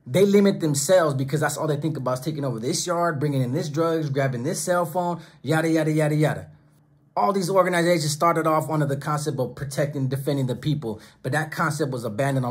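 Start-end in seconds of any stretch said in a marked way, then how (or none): none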